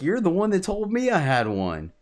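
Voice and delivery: high-pitched mock protest; retold indignation. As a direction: throw the blame back, playfully indignant. Retelling his indignant reply.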